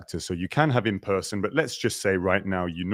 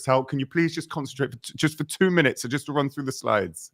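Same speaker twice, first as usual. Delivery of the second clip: mock pleading, pitched much higher; lightly voiced pleading producer; imitating producer; 7 semitones higher than usual. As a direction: play the patient producer begging for focus, high. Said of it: "He goes".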